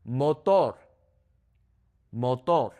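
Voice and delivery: Motor as moh-TOR, twice; Spanish "Motor" with pure o vowels; Spanish pronunciation. Shown as a demonstration. Teacher models vowels, Spanish Motor. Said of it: "focus on the vowel sounds".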